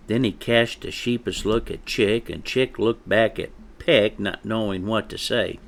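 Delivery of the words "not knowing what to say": usual speaking voice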